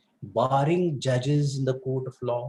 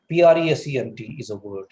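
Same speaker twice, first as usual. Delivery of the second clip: ordinary delivery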